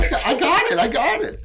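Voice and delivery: laughing. Real, not acted. GM teased, laughing it off.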